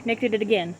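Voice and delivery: usual speaking voice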